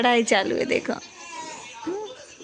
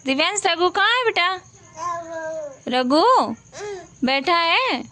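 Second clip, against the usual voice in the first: high, louder sing-song questions; 7 semitones higher, 3 dB louder than usual. Real, not acted. Mother asks toddler.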